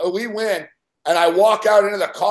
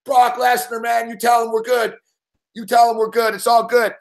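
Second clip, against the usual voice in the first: put-on drunken exuberance; 6 semitones higher than usual. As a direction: half-shout it, drunk and giddy. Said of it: "I'm all drunk".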